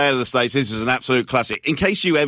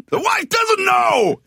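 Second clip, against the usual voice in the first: mock race-caller shout; much slower than usual. Echoing the race call's finish.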